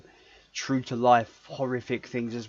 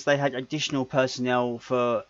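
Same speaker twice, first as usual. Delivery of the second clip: ordinary talk, nothing marked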